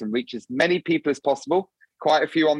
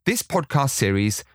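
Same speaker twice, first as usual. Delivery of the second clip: podcast intro narration; 3 semitones lower than usual. Acted. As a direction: read it like a polished show opening. Produced podcast intro read.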